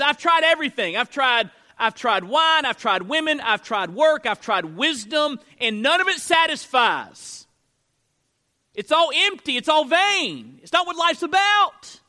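high, loud preaching voice as Solomon; Solomon's lament. Acted. Pastor voicing Solomon to the congregation, loud high lament. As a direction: cry it out as a weary king who tried everything. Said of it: "Solomon says".

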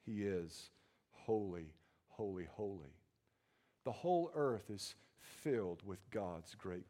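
low, soft holy holy holy; almost pray the words; hushed reverence. Acted. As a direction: reverent murmur. Quiet reverent repetition.

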